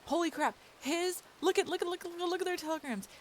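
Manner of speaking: high, fast, thrilled babbling; excited. Real, not acted. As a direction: gush over the discovery. Excited at telegram numbers.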